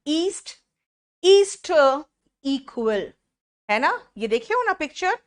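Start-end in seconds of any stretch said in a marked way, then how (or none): 0.1-3.1 "ईस्ट, ईस्ट इक्वल" with long opening "ई"; long E sound. Shown as a demonstration. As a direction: stretch the first E of "ईस्ट, ईस्ट इक्वल". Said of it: "ई का sound". ("ईस्ट, ईस्ट इक्वल" में लंबी ई)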